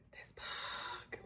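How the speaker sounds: breathless whisper of "This book"; barely a breath, overwhelmed. Real, not acted. Vlogger whispering to camera about the book, whisper.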